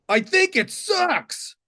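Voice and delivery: high, drawn-out mocking verdict; heckling stranger. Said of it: "And the guy goes".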